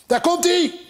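high excited bird cry; calling out. A narrator's reading. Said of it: "riep het vogeltje".

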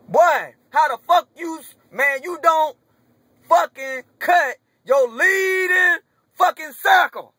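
loud, high, furious yelling at the team's front office; shouting in real outrage, voice cracking. Real, not acted. Fan yelling at his team over a cut: furious, cracking shout.

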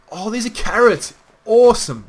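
high, loud burst of excitement; thrilled discovery. Real, not acted. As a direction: exclaim with real delight at a find. Thrilled at finding carrots in game.

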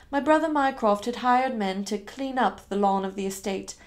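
ordinary delivery, nothing marked

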